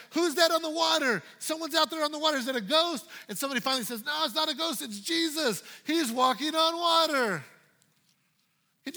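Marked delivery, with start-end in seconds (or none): 0.1-3.0 alarmed disciples. Acted. Disciples shouting at figure on water. 4.0-7.4 excited disciple. Acted. Disciple announcing Jesus.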